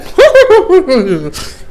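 high burst of real laughter. Real, not acted. Comedian to crowd, laughing at own joke, high burst of laughter.

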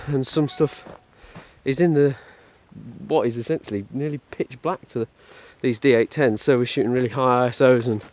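ordinary talk, nothing marked

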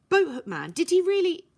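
can't believe what she saw; shocked disbelief. Acted. Violet to Herbie about Boathook Man, stunned and excited.

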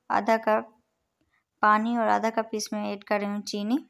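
normal talking voice

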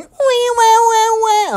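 very high squeaky mock wail; mock high-pitched wailing. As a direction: wail like a dying cat. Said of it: "if we heard this guy going".

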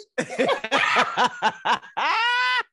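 high-pitched burst of laughter; high loud laugh. Real, not acted. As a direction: cackle loudly at the bit. Laughing at own spelling bee bit.

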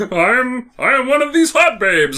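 a monster faking a sweet lady voice, then cackling; hammed-up disguised monster squealing; monster posing as a babe. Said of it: "Grendel's wearing a bra".

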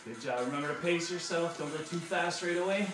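upbeat workout coach cues; aerobics instructor. Acted. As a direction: coach the class through drills. Fitness instructor cueing a workout.

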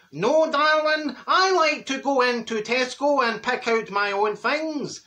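high, slow, drawn-out answer; acted Dad's reply. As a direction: stretch it out, calling back in character.

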